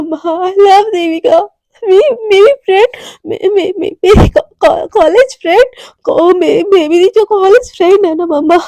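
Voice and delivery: loud, stammering, crying admission; tearful confession. Acted. Daughter confessing her love for a girl to her parents, crying. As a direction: choke out the words through tears.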